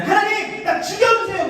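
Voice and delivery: high-pitched plea to God; whining complaint. Acted. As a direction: act out a fed-up tantrum. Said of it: "하나님한테 투정을 부립니다".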